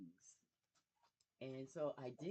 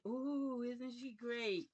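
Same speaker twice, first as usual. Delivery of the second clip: higher, louder, slow gushing praise; mock admiring gush; 6 semitones higher, 4 dB louder, slower than usual. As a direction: play a fawning fan, drawn out. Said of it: "for the world to just say".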